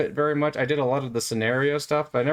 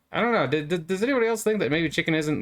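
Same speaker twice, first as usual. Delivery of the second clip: ordinary talk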